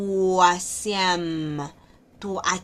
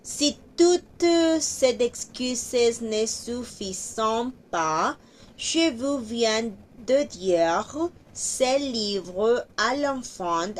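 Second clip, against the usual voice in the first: slow, lifted reading of the French line; 3 semitones higher, slower than usual. Shown as a demonstration. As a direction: read it slowly to the class. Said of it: "aquí también hay liación obligada". (lectura lenta en francés)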